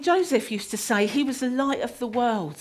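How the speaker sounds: ordinary delivery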